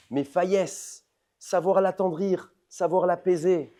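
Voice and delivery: loud, high, insistent preacher's voice; fiery preaching. Acted. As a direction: hammer the point home to the congregation. Preaching at full force.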